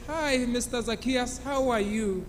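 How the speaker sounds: a soft, timid greeting at the door. Acted. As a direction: greet him softly, almost afraid. Lady greets the tax collector.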